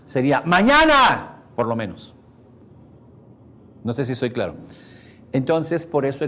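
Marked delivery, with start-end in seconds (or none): 0.4-1.4 slow, high, loud bellow; shouting. Shown as a demonstration. Teacher shows the whisper a thousand times louder, bellowing. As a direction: blast the word across the room. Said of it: "le subieras mil veces más".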